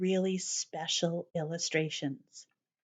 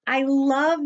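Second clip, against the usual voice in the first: excited; 6 semitones higher, 7 dB louder than usual. Real, not acted. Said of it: "I'm so excited to share it".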